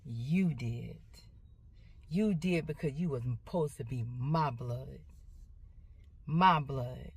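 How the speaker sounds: low, subdued, real hurt; tearful hurt. Real, not acted. Mother to the child who made her cry, tearful hurt. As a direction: say it low and soft, hurt to tears. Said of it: "didn't make me cry".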